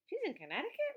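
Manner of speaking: high, loud disbelieving question; can't believe it; shocked she's so close; surprised. A narrator's reading. Surprised question from Ruth Rose.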